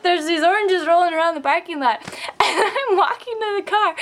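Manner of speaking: high voice bubbling with real laughter; laughing. Real, not acted. Telling of oranges rolling around, laughing. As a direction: keep talking through the giggles.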